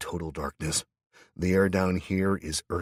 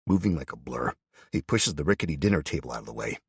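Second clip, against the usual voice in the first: usual speaking voice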